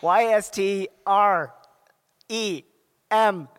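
slow letter by letter; spelling it out. Shown as a demonstration. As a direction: spell each letter with pauses. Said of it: "please spell Yastrzemski".

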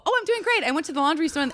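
high-pitched acted small talk; breezy reply. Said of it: "And you go".

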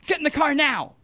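urgent shouted command, pitched high; retold yelled order. Stranger in the SUV orders him in, loud and urgent. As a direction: bark it like a panicky kidnapper. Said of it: "And they're like".

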